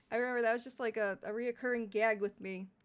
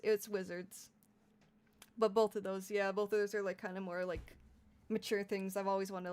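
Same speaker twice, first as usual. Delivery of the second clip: normal talking voice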